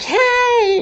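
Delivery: very high, mocking squeaky hey; squeaky mock girl. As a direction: pipe it like a cartoon girl. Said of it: "She's like".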